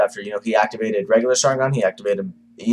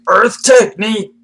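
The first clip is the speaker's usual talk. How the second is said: loud, high drawn-out battle cry; jutsu shout; 11 semitones higher, 7 dB louder, slower than usual. Retold or imitated. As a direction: belt out the technique name, stretched. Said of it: "the Tizukage is like".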